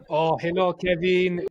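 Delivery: hammed-up French voice note greeting; mock French man leaving a message; French accent. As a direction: put on a comic French accent. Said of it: "when you try and do a French accent".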